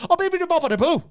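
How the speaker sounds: high, loud panicked babble; a wizard squealing nonsense at a bear; panicked wizard gibberish. Retold or imitated. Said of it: "and he goes".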